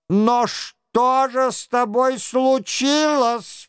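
high, slow, amazed questioning; astonished question. A narrator's reading. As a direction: ask in wide-eyed astonishment. Astonished king questions the blue man. (изумлённый вопрос)

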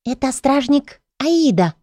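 high, quick fairy whisper; a tiny fairy hissing a warning; pixie whisper. A narrator's reading. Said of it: "Слышу вдруг шёпот прямо у уха".

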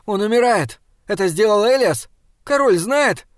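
high, loud, panicked questions; almost shouting. A narrator's reading. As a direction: nearly shout in alarm. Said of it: "Саймон почти кричал".

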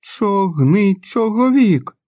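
put-on high, slow frightened cry of a child; a boy yelps the word in terror; sudden loud yelp. A narrator's reading. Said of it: "раптово зойкнув Джо, та так сильно і голосно". (різкий гучний зойк)

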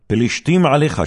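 high, loud urgent warning cry; alarmed call. Acted. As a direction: shout the warning to jolt him awake. Delilah calls out to wake Samson. (קריאת אזהרה רמה ודחופה)

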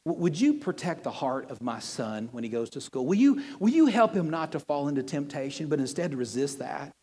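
soft, hushed, earnest prayer; sincere prayer. Shown as a demonstration. Pastor shows the congregation a better prayer, softly and sincerely. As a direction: pray it gently, meaning every word. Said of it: "Why don't you say something like this?".

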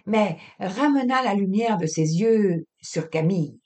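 ordinary delivery, nothing marked

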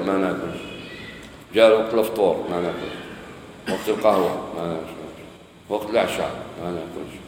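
low, soft mock sulk; quiet, dropped-voice refusals, lightly acted; sullen food refusal. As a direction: play the listless patient refusing every meal. Acts a person refusing every meal.

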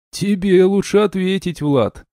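high-pitched amused advice; wry amusement. A narrator's reading. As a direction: advise with a smirk, starting high. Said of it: "усмехнулся Керт".

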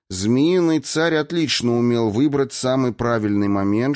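ordinary delivery

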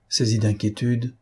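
ordinary delivery

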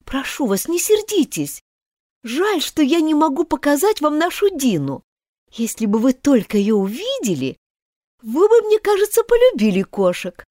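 put-on gently coax, a touch girlish; a hint of a polite little girl pleading; lightly voiced girl Alice. Alice voice in story reading.

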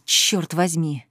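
low, slow breath-out curse; exhaled curse. Acted. Officer after escaping the crowd, low slow exhaled curse. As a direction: let it out on the breath. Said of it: "Стефан выдохнул".

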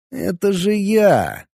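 drawn-out surprised realization. A narrator's reading. Recognizes himself on camera.

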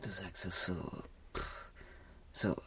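hurt murmur. Acted. Enttäuschte leise Antwort.

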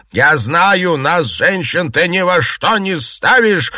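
indignant, emphatic outburst; angry scolding shout. A narrator's reading. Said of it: "закричала матушка Дзя".

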